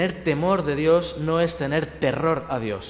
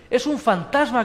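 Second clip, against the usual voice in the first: higher, mock-frightened shout; mock scared cry; 7 semitones higher, 3 dB louder than usual. Disciples seeing Jesus on the water, mock panic.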